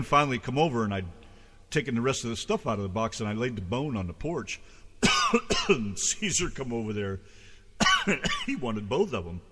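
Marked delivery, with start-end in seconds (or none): 4.6-5.6 choking cough, half retching; gagging cough. Real, not acted. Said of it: "Excuse me". 7.3-8.4 choking cough, half retching; gagging cough. Real, not acted. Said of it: "Excuse me".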